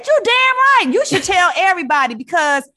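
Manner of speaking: you damn right, tell everybody; emphatic auntie. Retold or imitated. Auntie answers loudly.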